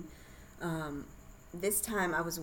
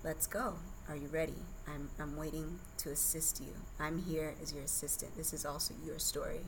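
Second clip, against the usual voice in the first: put-on soft, much quieter speaking to the baby; gently coaxing the baby out; a hushed, loving inner talk to her unborn child; tender inner voice; 7 dB quieter than usual. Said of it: "the communication that I was having with her".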